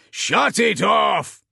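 high, drawn-out yelled command; urgent shout. A narrator's reading. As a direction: shout the order over the din of battle. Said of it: "he shouted".

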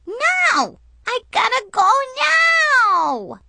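high, loud, whiny demand; shrill little girl yell. Acted. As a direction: stamp your foot and insist. Sister demands loudly.

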